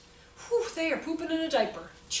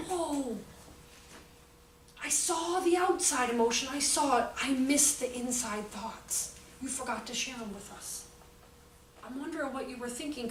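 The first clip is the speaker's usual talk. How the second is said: put-on soft, gentle words to a child; calm teacher script; 6 dB quieter than usual. Shown as a demonstration. Teacher calmly asks an upset child to share thoughts, soft and gentle. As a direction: talk it through calmly with the child. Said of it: "the best thing to do is say".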